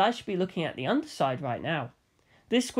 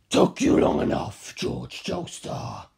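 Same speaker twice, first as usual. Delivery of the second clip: deep, slow, menacing giant; towering fighter greets the hero in a deep slow voice; Kaz voice; 7 semitones lower, slower than usual. A narrator's reading. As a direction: loom over him with a low slow growl. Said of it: "he said".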